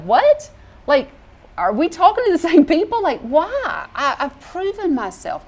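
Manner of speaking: high-pitched, indignant disbelief; acted incredulity. Preacher acts out a distrusted best friend, incredulous. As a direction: jump up in pitch, baffled and affronted. Acting out a betrayed friend's reaction.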